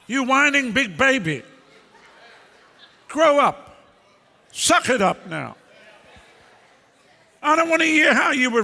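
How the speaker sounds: mock tough-love tirade; mock scolding shout. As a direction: bark it at the crybaby congregation. Preacher berating whiners.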